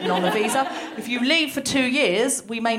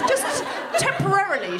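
breezy clerk; 10 semitones higher than usual. Retold or imitated. Quoting breezy Home Office clerk.

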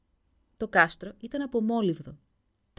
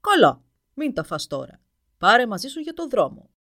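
ogre roar; 3 semitones higher, 12 dB louder than usual. A narrator's reading. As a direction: roar like a hungry dragon. Dragon replies. (βροντερή φωνή δράκου που βρυχάται)

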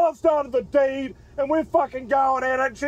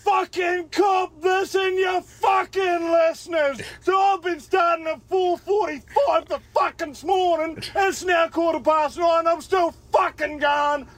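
shouting; 4 semitones higher, slower than usual. Real, not acted. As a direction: bellow it like an excited caller. Caller shouting in on the phone.

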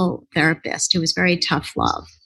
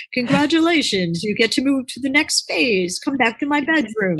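higher, bright announcer voice; hammed-up host announcing the next round; mock game show host; 6 semitones higher than usual. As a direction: play a game show host awarding a prize. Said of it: "it wasn't like I said".

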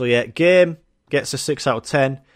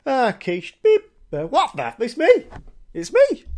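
rising startled yelp, getting higher and louder; startled jump; 10 semitones higher, 3 dB louder than usual. Retold or imitated. Shopper startled by the self-checkout screen, yelping louder. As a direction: act jumping at your own face on the screen. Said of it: "you fucking shit yourself".

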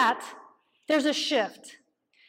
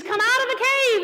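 ringing call; 7 semitones higher, 4 dB louder than usual. Acted. Voicing God's call.

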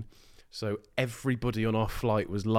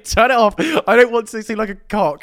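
panicked shout; 10 semitones higher, 11 dB louder than usual. Retold or imitated. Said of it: "So I was saying".

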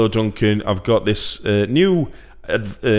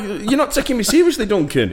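high-pitched mock indignation; indignant protest; 11 semitones higher than usual. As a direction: protest playfully to a doubting investor. Imagined protest to the investor.

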